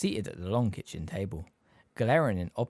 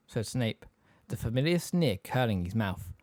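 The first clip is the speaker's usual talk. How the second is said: plain reading voice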